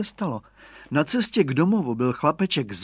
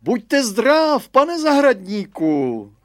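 loud, very high, drawn-out hail; calling out; 10 semitones higher, 6 dB louder, much slower than usual. A narrator's reading. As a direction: hail the gardener across the fence.

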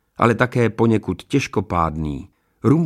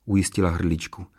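usual speaking voice

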